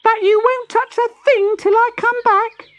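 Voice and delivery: put-on very high, stammering baby tortoise; the baby makes the parents swear, stumbling. A narrator's reading. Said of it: "said the baby".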